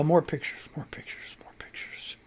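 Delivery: fading into a whispered mumble; muttering to self. Real, not acted. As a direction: mutter it to yourself, trailing off to a whisper. Muttering to self while searching pictures.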